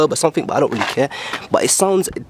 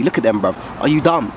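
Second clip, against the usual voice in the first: ordinary delivery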